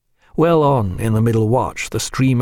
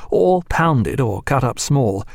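ordinary delivery, nothing marked

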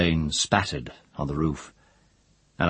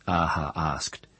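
ordinary delivery, nothing marked

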